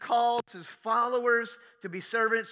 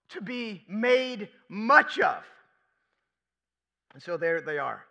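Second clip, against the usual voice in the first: ordinary delivery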